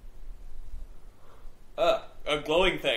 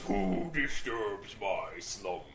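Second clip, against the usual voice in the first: lower, very slow rumbling voice; grinding stone statue; 7 semitones lower, 6 dB quieter, much slower than usual. Retold or imitated. Imitating Aladdin's cave guardian.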